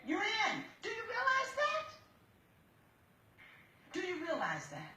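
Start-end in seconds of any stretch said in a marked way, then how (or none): 0.8-1.9 high-pitched challenge, rising at the end; insistent questioning. Real, not acted. Judge presses the daughter, first asking. 3.9-4.9 softer question, falling steadily at the end; pointed repeat. Real, not acted. Judge repeats the question more quietly.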